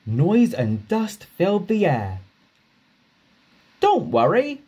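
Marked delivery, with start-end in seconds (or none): 3.8-4.6 higher, cheerful Toby; a lightly voiced friendly engine; Toby's voice. Toby reassures Duck, higher and cheerful. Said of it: "whistled Toby".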